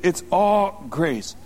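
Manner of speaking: ordinary delivery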